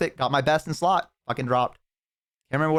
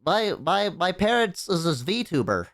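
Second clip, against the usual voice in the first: high, slow put-on kid voice; 6 semitones higher, slower than usual. Kid telling friends about VTuber parent, high kid voice. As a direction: talk like a shy child. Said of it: "they're like".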